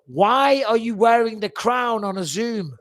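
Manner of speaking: high, drawn-out, loud protest; exasperated disbelief. Real, not acted. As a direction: slowly plead in loud disbelief. Mock protest at crown on call.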